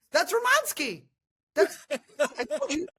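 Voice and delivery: a touch of Sarah laughing as she explains it; laughing explanation. Retold or imitated. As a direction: laugh through an exasperated explanation.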